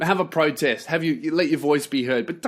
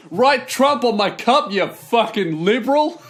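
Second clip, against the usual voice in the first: higher, taunting sneer at the barista; smug jerk customer; 6 semitones higher than usual. Retold or imitated. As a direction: taunt her like a gloating troll. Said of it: "he's like".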